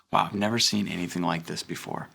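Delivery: quiet amazed wow; soft, low awe; hushed wonder. Pretend. Pastor voices the congregation's hoped-for awe, hushed.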